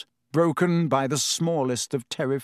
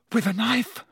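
high, frightened question; terrified; 9 semitones higher than usual. A narrator's reading. Ron waking his dormitory after an intruder, terrified. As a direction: voice pitched up in fear. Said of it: "a look of utmost terror".

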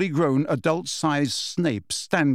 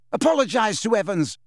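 raised, high-pitched angry order; roar; 8 semitones higher than usual. A narrator's reading. James threatening Snape with his wand, mild roar. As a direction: bark the order with some restraint. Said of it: "James roared at Snape".

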